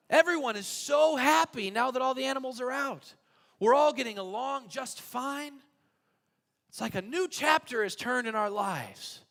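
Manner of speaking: high, loud, delighted gushing; a grateful man thanking the rabbi; overjoyed thanks. Acted. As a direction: bubble over with relief and gratitude.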